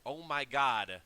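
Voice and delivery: loud, mock-stunned realization; retold moment of sudden realization; imitated dawning realization. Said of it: "the guy knew".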